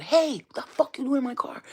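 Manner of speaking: loud, high shout of challenge; re-enacted outraged holler; retold angry yell. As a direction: yell at someone caught in your car. Retold yelling at car thief.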